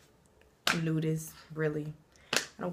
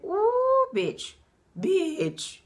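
road rage; 9 semitones higher, 6 dB louder, much slower than usual. Pretend. Cut off in traffic.

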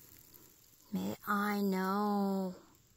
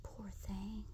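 sympathetic whisper; 2 semitones lower, 9 dB quieter than usual. Real, not acted. Pitying the animal.

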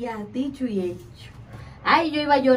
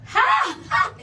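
mock spirit laugh; 9 semitones higher, 5 dB louder than usual. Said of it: "y se reía como".

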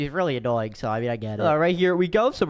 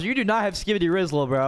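normal talking voice

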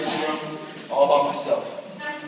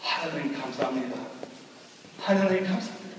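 softer, a mother's hushed thanks to God; quiet thankful prayer; 5 semitones lower, 11 dB quieter, much faster than usual. Retold or imitated. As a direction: murmur the prayer gently, as if you are barely holding on. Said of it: "And she said".

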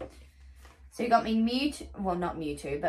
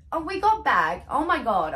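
sudden loud gasp of realisation; excited outburst; 6 semitones higher, 6 dB louder than usual. Real, not acted. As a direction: blurt it out, suddenly remembering.

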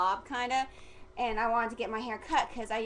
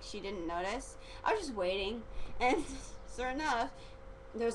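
usual speaking voice

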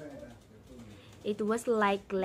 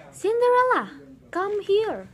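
high, loud stepmother calling out; wicked stepmother; 11 semitones higher, 8 dB louder than usual. Acted. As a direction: shout for Cinderella like a wicked stepmother. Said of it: "said her stepmother".

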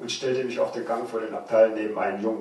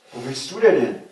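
stern challenge; 4 semitones higher, 9 dB louder than usual. Acted. Guard grabs boy's arm.

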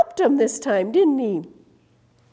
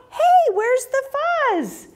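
much higher, bewildered little animal voices; squeaky prairie dogs; 14 semitones higher than usual. Acted. Prairie dogs wake, missing fuzz.

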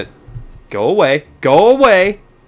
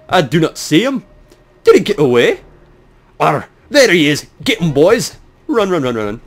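put-on hammy pirate accent; pirate searcher; 5 semitones higher than usual. Pirate hunting the intruder with his boys, mock pirate voice. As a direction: play a pirate rallying his crew.

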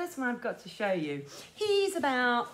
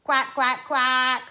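loud, steady duck quacks; 8 dB louder than usual. Shown as a demonstration. Showing children the duck noise, loud quacking. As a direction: demonstrate a loud quack, last one drawn out. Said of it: "I'm gonna say".

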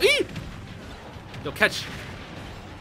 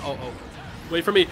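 usual speaking voice

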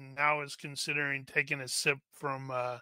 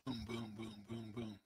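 low, very soft rhythmic booms; imitated booming; 5 semitones lower, 16 dB quieter than usual. Game master sounds out the charge, soft booming. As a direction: murmur the thuds under the voice, evenly.

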